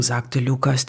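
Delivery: plain reading voice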